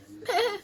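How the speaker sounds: high bleating sheep noise; imitated sheep bleat. Storyteller plays the sheep Moses watches, high mock bleat.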